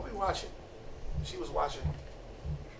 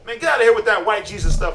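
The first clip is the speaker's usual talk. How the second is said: louder, higher dismissive outburst; mock irate husband; 5 semitones higher, 6 dB louder than usual. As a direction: imitate a husband chasing someone out. Husband dismisses the fake preacher.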